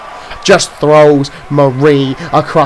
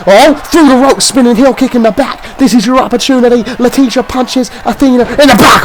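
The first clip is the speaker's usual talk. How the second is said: excited commentary shout; 7 semitones higher than usual. Real, not acted. Commentator yelling as Leticia fights back, excited. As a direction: call the spinning kicks at the top of your voice. Commentator calls a big comeback.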